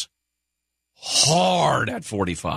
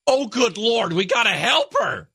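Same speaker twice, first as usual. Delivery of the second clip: pitched way up, mock alarmed cry; alarmed helper; 11 semitones higher than usual. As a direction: squeal it like a panicked bystander.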